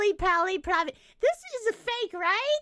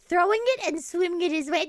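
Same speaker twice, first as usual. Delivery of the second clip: ordinary talk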